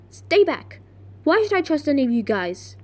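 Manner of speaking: acted defensive warning; wary, forceful challenge; Riley. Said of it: "Riley was about to go".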